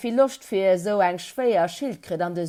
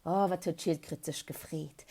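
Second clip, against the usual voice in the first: plain reading voice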